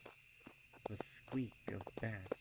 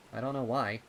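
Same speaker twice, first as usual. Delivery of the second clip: put-on louder kid voice; a young colt shrugging it off; 3 semitones higher, 10 dB louder, faster than usual. Rumble voiced.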